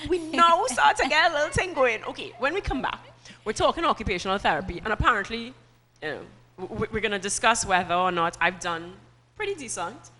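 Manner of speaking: loud, upbeat break announcement; TV host sign-off. Acted. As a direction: tease what's after the break. Host throws to commercial break.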